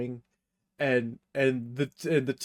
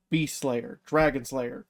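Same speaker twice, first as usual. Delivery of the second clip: normal talking voice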